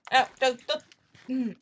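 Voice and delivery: ordinary delivery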